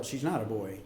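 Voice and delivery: ordinary talk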